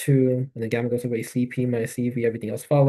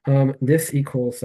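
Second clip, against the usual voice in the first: normal talking voice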